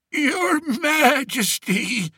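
wheezed. Acted. Old shepherd on his knees before the king, wheezing. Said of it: "he wheezed".

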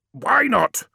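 high, sharp demand; angry challenge. Acted. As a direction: demand an answer at once. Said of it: "demanded Magnus".

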